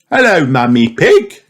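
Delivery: a lightly voiced librarian greeting; Miss Rabbit greeting. A narrator's reading. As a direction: greet her cheerfully. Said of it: "she says".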